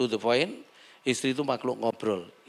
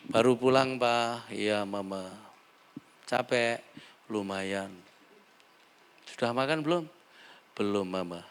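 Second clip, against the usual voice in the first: soft, lower, tender replies; gentle couple talk; 4 semitones lower, 4 dB quieter than usual. Retold or imitated. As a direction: speak kindly as the good husband. Gentle couple exchange contrasted. (suami istri bicara lembut dan manis)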